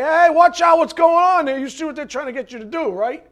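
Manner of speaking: calling out a friend about to be deceived; alarmed warning call. Retold or imitated. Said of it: "someone like us would say".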